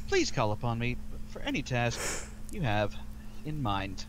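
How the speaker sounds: booming metallic robot; loud, formal droid voice at times; protocol droid. Acted. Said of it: "You can do it in a robot voice".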